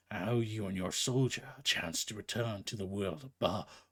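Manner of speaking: low menacing whisper from the lord of the dead; whispered threat. Acted. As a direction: breathe the threat in a deep whisper. Hades warns of eternal torture.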